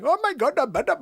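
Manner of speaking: quick little burst of mock Indian accent; short Indian accent. Shown as a demonstration. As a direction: toss off a short, high Indian-accent snippet. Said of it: "the really short one".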